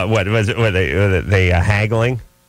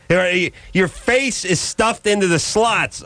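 mock indignity; 7 semitones higher than usual. Acts out humiliation at the cashier window.

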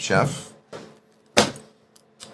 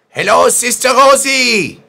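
high, loud cheerful shout; loud greeting; 14 dB louder than usual. Pretend. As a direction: belt out a hello.

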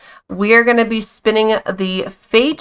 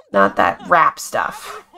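put-on low, slow, cranky old lady grumbling; grumpy grandma; 10 semitones lower, slower than usual. Voicing the grumpy grandma Sim, low and slow. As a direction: sneer it like a grandma who hates modern music. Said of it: "That's what she'd be saying right now".

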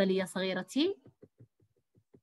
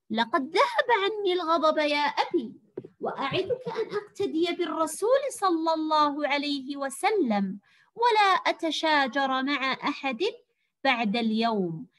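put-on high, loud, slowed boyish voice for the son; voiced boy Fawaz; 7 semitones higher, 3 dB louder than usual. Shown as a demonstration. Teacher reading Fawaz's line to pupils, high and loud. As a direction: read the son's amazed question with colour. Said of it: "أن ألون صوتي وفق ما يقتضي المعنى".

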